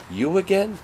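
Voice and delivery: a high, teasing you again; mock surprised jab. Comic retelling to an idol, a teasing high jab. Mocking idol who keeps seeing him.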